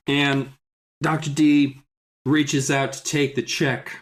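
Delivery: ordinary delivery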